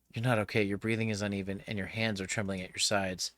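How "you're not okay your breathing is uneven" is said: plain reading voice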